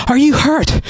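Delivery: high anxious ask; urgent, frightened question; worried question. A narrator's reading. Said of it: "asked Galen".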